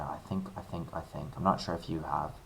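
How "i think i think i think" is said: soft whisper to herself; whispered musing. Real, not acted. Teacher puzzling over the problem, whispering to herself. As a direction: think aloud in a hush.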